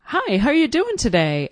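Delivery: a hint of an overeager clerk; cheery clerk greeting. Store clerk jumping out at a shopper, mock chirpy greeting. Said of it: "and says".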